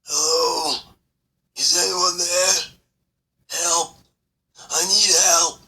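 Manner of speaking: high desperate trapped voice; calling for help. Acted. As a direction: call out, exhausted and desperate. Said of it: "an exhausted, guttural voice calling".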